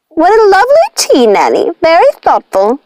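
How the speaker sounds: high, sugary fawning voice; fawning witch. A narrator's reading. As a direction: gush over the tea like a flattering witch. Ruby fawning over the tea.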